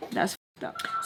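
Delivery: breathy whispered echo of That's fucked up; whispered repeat. Real, not acted. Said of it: "And that's fucked up".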